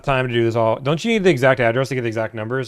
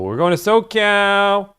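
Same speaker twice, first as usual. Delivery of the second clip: bright, rising thrill; excited; 8 semitones higher, 3 dB louder, slower than usual. Real, not acted. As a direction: stretch it out, delighted. Excited find of a dealer.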